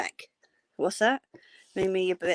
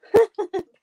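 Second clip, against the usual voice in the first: loud laugh; 13 semitones higher, 10 dB louder than usual. Real, not acted.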